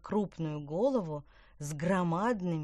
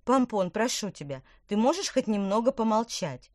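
exasperated plea, louder; irritated; 5 semitones higher, 6 dB louder than usual. Acted. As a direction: snap at him impatiently. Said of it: "раздражённо притопнула ногой".

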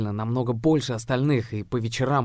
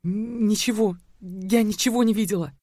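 higher, stammering frightened denial; a scared girl stammering her denial; 12 semitones higher, 4 dB louder than usual. A narrator's reading. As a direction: barely get it out, terrified. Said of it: "прошептала она".

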